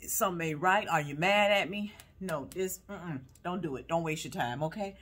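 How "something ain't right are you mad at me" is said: talking stomach. Pretend. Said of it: "Got my stomach saying".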